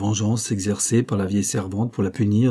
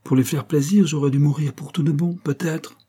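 high-pitched, sarcastic suggestion; piqued irony; suppressed irritation; 6 semitones higher than usual. A narrator's reading. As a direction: retort bitterly with raised pitch. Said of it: "Rose réprima un mouvement d'humeur".

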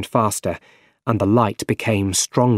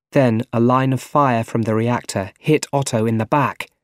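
usual speaking voice

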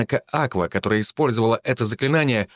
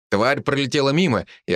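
ordinary delivery, nothing marked

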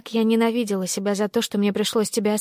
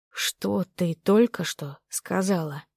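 slow, measured question with gaps; word by word; slower than usual. A narrator's reading. Stunned man demanding she repeat it, word by word. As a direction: separate each word deliberately. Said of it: "Отделяя каждое слово, произнёс он".

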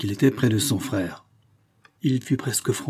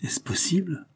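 hushed, high-pitched disbelief; astonished question, soft and raised; stunned, barely believing it; immense surprise; 7 semitones higher, 5 dB quieter than usual. A narrator's reading. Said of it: "tous les signes d'une surprise immense".

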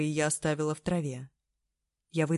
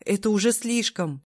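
alarmed protest; 4 semitones higher, 4 dB louder than usual. Acted. Heroine protests attack.

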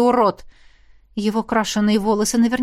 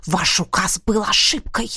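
змеиное шипение; 2 semitones lower than usual. Acted. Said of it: "как змея прошипел".

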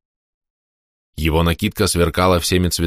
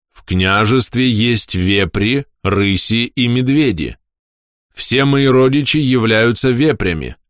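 slow, patient prince explaining; slower than usual. Acted. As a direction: explain it slowly and plainly. (медленно, терпеливо объясняя)

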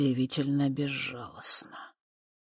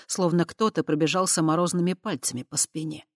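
ordinary delivery, nothing marked

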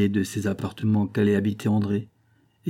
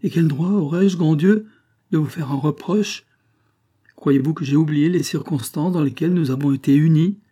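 higher, louder indignant plea; heated protest; 6 semitones higher, 5 dB louder than usual. A narrator's reading. Wife protesting to her husband, heated and higher. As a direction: push back with rising heat.

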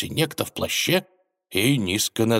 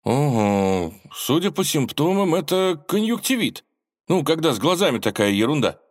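higher, self-important expert voice; know-it-all; 9 semitones higher than usual. Acted. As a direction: pronounce it like a smug doctor. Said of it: "сознанием дела заявил".

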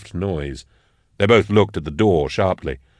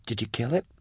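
soft, higher, rising question; very small voice; 4 semitones higher, 10 dB quieter than usual. A narrator's reading. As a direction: shrink the voice to ask timidly. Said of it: "her voice very small".

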